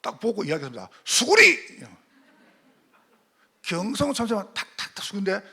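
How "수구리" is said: sudden loud high cry of "duck!"; shouted warning. Acted. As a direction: yell it out to save the others.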